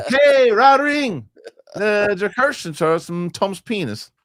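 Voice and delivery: stretched-out squeaky high quote voice; slow mock Simlish-like squeak. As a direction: draw each name out high and squeaky. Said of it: "Are you guys doing Simlish?".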